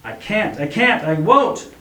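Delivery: loud, high, desperate scream; screamed refusal. A narrator's reading. Father screaming that he cannot choose, anguished. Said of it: "he screamed".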